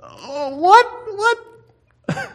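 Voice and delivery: very high, squeaked mock disbelief; mock incredulity. Looking back at today's worries from heaven, mock incredulous. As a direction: shoot way up high, comically baffled.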